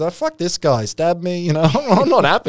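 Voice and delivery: hammed-up sulky mobster; mock aggrieved gangster. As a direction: voice the stabbed man's indignant complaint. Rival gangster's voiced grievance.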